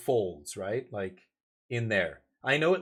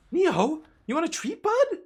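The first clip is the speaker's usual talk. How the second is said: much higher, louder excited call to the dog; really excited tone; 6 dB louder than usual. Real, not acted. Owner calling his dog Neo for a treat, excited high call. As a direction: sound thrilled so the dog comes running. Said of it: "If I use the really excited tone".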